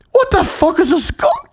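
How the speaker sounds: high, baffled mock mum voice; bewildered mum. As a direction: squeak it out like a puzzled mum. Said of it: "And she goes".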